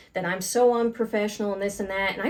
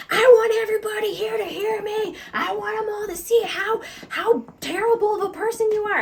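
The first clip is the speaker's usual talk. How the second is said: much higher, louder mock scream; retold screaming; 12 semitones higher, 4 dB louder than usual. Allison screaming at her boss in the store, high mock scream. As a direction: shriek it at the whole store like the furious coworker. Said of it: "She just starts screaming at me at the top of her lungs".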